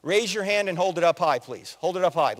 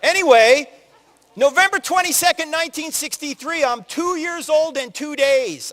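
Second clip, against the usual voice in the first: ordinary talk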